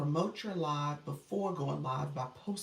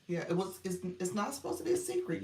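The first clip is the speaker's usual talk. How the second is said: ordinary talk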